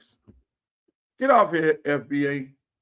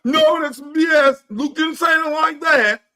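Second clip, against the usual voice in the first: higher, slow outraged objection; slow, raised disbelief from the public; indignant public; 8 semitones higher, 5 dB louder, slower than usual. Retold or imitated. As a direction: play a crowd calling it out. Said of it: "the public will be like".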